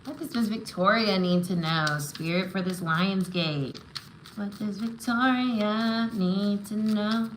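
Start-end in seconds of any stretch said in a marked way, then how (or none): 4.4-7.3 slow, held-note invocation; intoned card-reader question. Real, not acted. Reader asking spirit for Victoria's message, slowly intoned. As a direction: stretch each word out while waiting for the answer. Repeats question while drawing cards.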